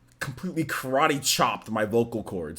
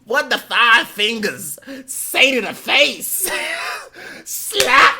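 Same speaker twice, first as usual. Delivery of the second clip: higher, louder, slow punchline delivery; comedy sketch impression; 10 semitones higher, 6 dB louder, slower than usual. Streamer reciting the sketch line, slow and loud. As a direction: drag out the setup then slap the punchline.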